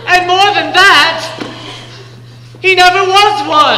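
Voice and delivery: stagey loud disclosure; emphatic reveal. Acted. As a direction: hammer home the betrayal. Apprentice adds to revelation.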